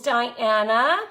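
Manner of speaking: normal talking voice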